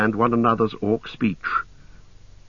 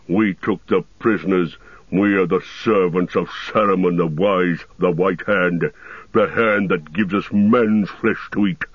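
heavy orc growl, commanding; Ugluk. A narrator's reading. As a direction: growl a boastful, scornful tirade. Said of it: "growled Ugluk".

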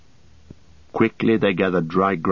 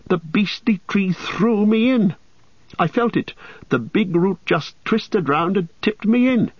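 high-pitched, aggrieved hobbit protest; indignant complaint; 10 semitones higher than usual. A narrator's reading. Frodo telling Sam the tree threw him in, high and indignant. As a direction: complain in a high, put-upon voice.

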